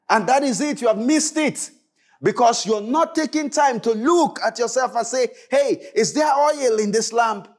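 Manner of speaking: high, urgent sermon warning; fervent preaching. Acted. Preacher warning congregation of an empty lamp, fervent and urgent. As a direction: warn the congregation with rising force.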